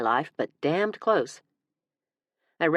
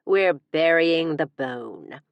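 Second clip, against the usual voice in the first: drawn-out, louder tease; slow cheeky innuendo; 4 dB louder, much slower than usual. Acted. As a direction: stretch every word suggestively.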